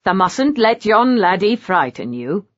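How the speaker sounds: lightly voiced Yorkshire maid, reassuring; a gentle touch of broad Yorkshire; Yorkshire accent. Long Molly soothing Jane in a Yorkshire accent. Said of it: "in her perfect Yorkshire accent".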